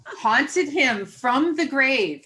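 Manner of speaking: laughing through it, slow and loud; slow hearty laughing line. Real, not acted. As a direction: laugh out the words. Laughing at the story.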